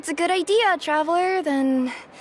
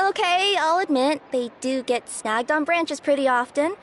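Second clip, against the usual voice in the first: usual speaking voice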